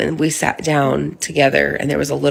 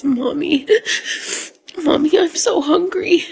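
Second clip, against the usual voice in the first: lightly voiced crying toddler, pitched up; crying child; 13 semitones higher than usual. Mother imitating her son's cry, lightly voiced. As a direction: mimic a hungry child crying out. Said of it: "He says".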